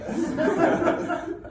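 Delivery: high, loud laugh; loud burst of laughter. Real, not acted. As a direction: break out laughing hard. Big laugh after joke.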